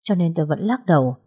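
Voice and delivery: ordinary delivery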